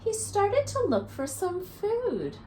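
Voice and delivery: lively storybook read-aloud for little ones; reading to children. A narrator's reading. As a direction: read it to a circle of kids, counting along. Reads picture book to children.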